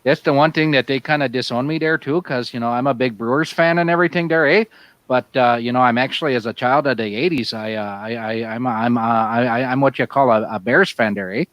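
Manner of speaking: hammed-up Yooper Wisconsin accent, "you betcha there, eh?". Bragging about deer camp to friends, Yooper accent. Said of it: "trying to do more Yooper or more Canadian".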